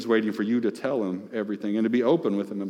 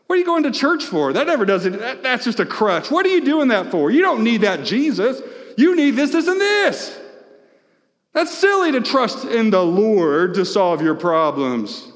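high, loud sneering scoffer; mock the believer with contempt; scornful mockery; 9 semitones higher, 4 dB louder than usual. Scoffers mocking churchgoing.

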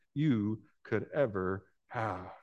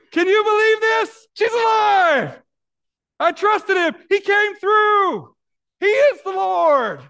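high, loud overjoyed cry of a father; joyful shout; 11 dB louder, slower than usual. Acted. Jairus celebrating his revived daughter, overjoyed loud shout. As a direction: shout it out like a father whose child lives.

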